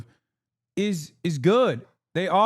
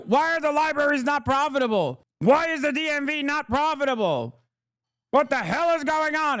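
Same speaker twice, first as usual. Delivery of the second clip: mock outrage; 9 semitones higher than usual. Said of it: "we're like".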